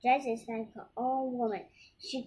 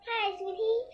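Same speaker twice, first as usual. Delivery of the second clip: high, happy dwarf greeting; 9 semitones higher than usual. A narrator's reading. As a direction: greet cheerfully, pitched well up. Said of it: "says a happy dwarf".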